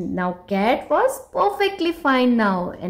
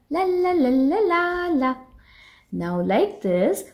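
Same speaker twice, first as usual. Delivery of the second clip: ordinary delivery, nothing marked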